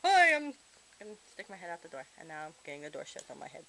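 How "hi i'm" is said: parrot voice. Pretend. Parrot voice greeting.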